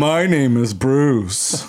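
slow, put-on macho introduction; butched-up voice. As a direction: stretch it out in a butch voice. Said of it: "butching up your voice".

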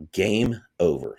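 slow, deliberate "Game over", each word weighted; slow, emphatic. Real, not acted. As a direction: stretch out "Game over" like a final verdict. Repeats it slowly for emphatic effect.

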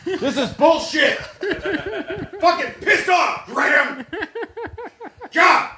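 high, drawn-out yelling tantrum; mock outrage. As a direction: storm off in hammed-up fury, laughter underneath. Pretends to storm off angrily.

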